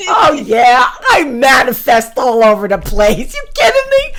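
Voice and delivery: loud, thrilled brag; excited shout. Real, not acted. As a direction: belt it out, buzzing with delight. Excited brag about manifesting.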